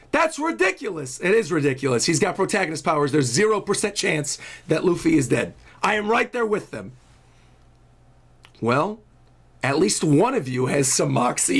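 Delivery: ordinary talk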